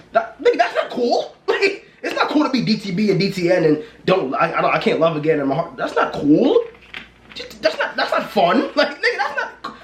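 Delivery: high, incredulous protest with laughs; laughing disbelief. Real, not acted. Scolding people for bragging about heartbreak, laughing and incredulous.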